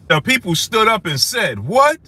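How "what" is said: high, rising, louder indignant what; outraged what. Retold or imitated. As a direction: throw the What? up high like a stunned crowd. Crowd's stunned reply, retold.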